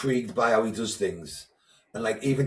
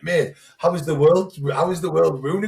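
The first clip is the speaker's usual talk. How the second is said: retold exasperated outburst, higher and louder; 7 semitones higher, 7 dB louder than usual. Retelling his reply to an over-apologetic man, exasperated. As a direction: protest in disbelief, pitched up and louder.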